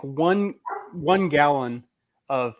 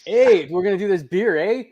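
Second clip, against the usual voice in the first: louder, higher mock Canadian with a stutter; a light Canadian impression ending on eh; Calgary beer reviewer with a light Canadian eh; 4 semitones higher, 5 dB louder, slower than usual.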